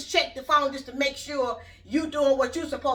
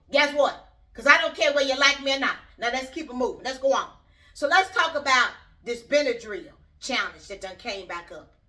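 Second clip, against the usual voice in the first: ordinary delivery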